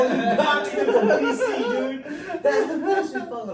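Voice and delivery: very high, loud, cracking with laughter; laughing mock yell. Speaker reacting to insult, laughing high yell. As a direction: shriek it through laughter.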